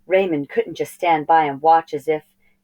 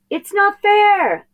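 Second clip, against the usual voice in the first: high, loud, drawn-out whine; whiny outburst; 8 dB louder, slower than usual. Acted. Boy loses his ship, wails high and loud. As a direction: wail it like a sulking kid.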